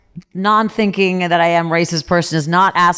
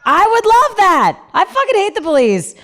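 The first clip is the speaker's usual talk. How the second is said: real gleeful outburst; eager burst; 11 semitones higher than usual. Real, not acted. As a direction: blurt it with delight. Eager reply to police question.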